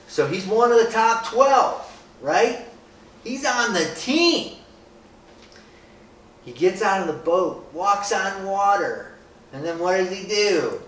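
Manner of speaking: rally the group behind Peter's story; preaching with fire. Acted.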